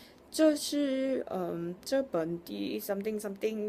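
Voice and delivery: a lightly mocked stranger sounding out the characters; imitating him reading Chinese. Stranger reading Chinese characters aloud to her, slow and halting. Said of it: "he started reading it to me".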